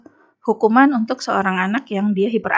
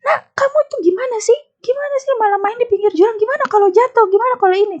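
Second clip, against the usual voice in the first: put-on shrill-pitched scolding of a child at a cliff edge; imitating an angry mother shouting at her kid; scolding yell; 12 semitones higher than usual. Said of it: "Dia teriak-teriak".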